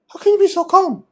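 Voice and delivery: high, loud disbelieving cry; astonished question. Retold or imitated. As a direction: marvel at his calm, high. Astonished student retold.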